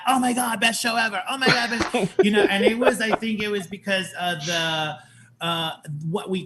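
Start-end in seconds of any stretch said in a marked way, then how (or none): 0.1-2.1 excited gush. Retold or imitated. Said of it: "I was like".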